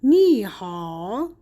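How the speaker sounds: slow, joined "نی ها" with "نی" changed to second tone; drawn-out sandhi demo of "نی ها"; tone two plus three. Shown as a demonstration. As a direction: say "نی ها" as second tone then dipping third.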